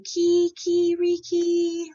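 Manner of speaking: long high drawn-out cock-a-doodle crow; rooster crow. A narrator's reading. Little rooster greets the morning with a high crow. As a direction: crow like a proud rooster at dawn. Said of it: "He sang".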